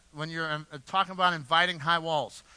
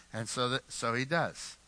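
ordinary talk, nothing marked

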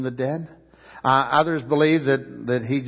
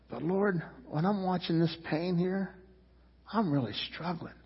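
soft, hushed confession of struggle; softly pleading with the Lord; quiet prayer; 4 semitones higher, 8 dB quieter than usual. Acted.

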